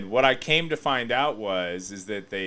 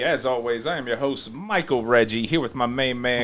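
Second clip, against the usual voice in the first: loud, fast show-host hype; auctioneer voice; 3 semitones higher, 9 dB louder than usual. Acted. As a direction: rattle it off like an auctioneer. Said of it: "You sound like an auctioneer".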